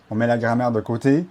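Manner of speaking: ordinary talk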